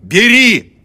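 piercing high shout. A narrator's reading. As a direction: scream it at him. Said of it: "крикнула она". (пронзительный крик)

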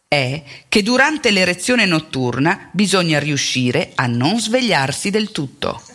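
ordinary delivery

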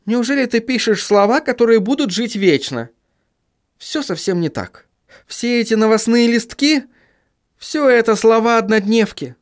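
high, reassuring reporter; consoling female character. Acted. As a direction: talk him down in a lighter, high female voice.

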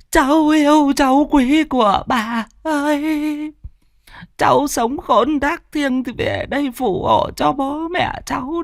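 put-on very high, slow, wailing old grandmother; keen over the body like a grieving granny; grandmother's lament. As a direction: grandmother mourning her dead granddaughter, high slow wail. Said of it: "chắp tay lên ngực lẩm bẩm".

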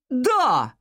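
high, long call of assent; stretched, carrying reply; shouting back. A narrator's reading. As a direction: yell a short answer downstairs. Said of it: "крикнул он в ответ".

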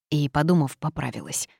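ordinary delivery

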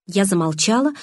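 ordinary delivery

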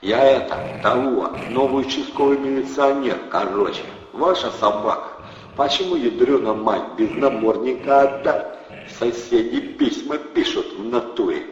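hammed-up local cop act; mock cop thug. A narrator's reading. Thug pretends to be a policeman, loud and brash.

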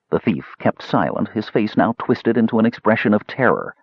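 ordinary delivery

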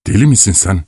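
usual speaking voice

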